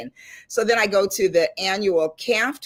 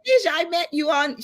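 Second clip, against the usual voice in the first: excited recognition; 11 semitones higher, faster than usual. Retold or imitated. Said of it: "he goes".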